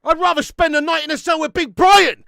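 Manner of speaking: loud furious rant; angry rant. Real, not acted. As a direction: rant it out, fired up. Ranting about taking a draw.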